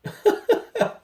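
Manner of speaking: breathy laugh at himself after ranting; sheepish laugh. Real, not acted. Vlogger laughing at his own rant, sheepish laugh. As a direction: laugh off the outburst. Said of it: "Sorry, I'm getting riled up".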